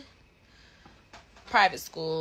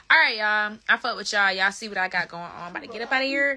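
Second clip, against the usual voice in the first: louder, higher, upbeat goodbye; excited sign-off; 6 semitones higher, 11 dB louder than usual. Real, not acted. Saying goodbye to viewers, louder and higher. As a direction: wrap it up with energy.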